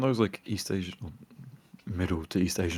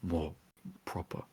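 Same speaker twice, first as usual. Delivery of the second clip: posh speech; 3 semitones lower, 12 dB quieter than usual. Shown as a demonstration. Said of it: "I like to speak more proper".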